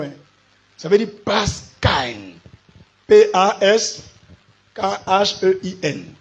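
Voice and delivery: loud, slow spelling out for the congregation; preacher drilling a new word; emphatic pulpit teaching. Real, not acted. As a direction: announce each word and letter like a lesson.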